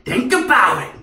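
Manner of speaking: high, loud menacing mock villain; movie villain impression. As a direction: hiss threats like the crazy guy. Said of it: "He says".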